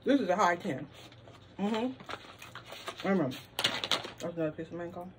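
talking while chewing; mouth full, chewing. Real, not acted. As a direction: food in mouth. Seen 2: Ate snack seconds before; lips pursed, chewing while holding bag.

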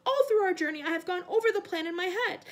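high, loud frantic pleading; frightened servant in full protest; panicked. A narrator's reading. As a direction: argue desperately with your master. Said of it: "panic in his voice now".

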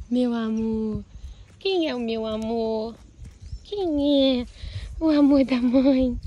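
slow soft doting coo to a pet; soft cooing to horse. Real, not acted. Owner cooing softly to her beloved horse. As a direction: melt over the horse, slowly.

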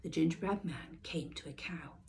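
ordinary delivery

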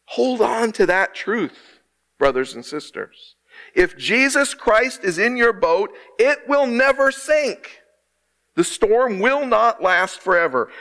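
rising, slow, impassioned preacher's cry; preaching at full force. Acted. Pastor rallying the congregation through the storm, preaching hard. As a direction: lift it up like a revival call.

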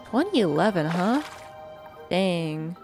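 normal talking voice

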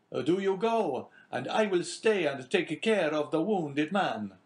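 high, slow, earnest sailor's offer; eager young sailor. A narrator's reading. Jacopo urging his captain to leave him behind, high and earnest.

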